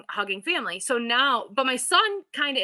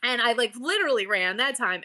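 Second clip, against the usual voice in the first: ordinary delivery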